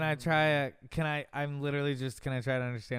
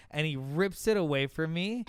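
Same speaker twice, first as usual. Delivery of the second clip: ordinary talk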